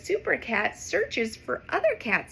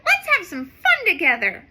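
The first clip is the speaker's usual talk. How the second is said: louder happy invitation; cheerful; 5 semitones higher, 8 dB louder than usual. Acted. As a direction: sound eager. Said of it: "says Supergirl".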